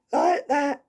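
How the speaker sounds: half-hearted husky imitation of a straining voice; lighter husky ghost voice. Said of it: "kinda like husky, as I say, trying to struggle to talk".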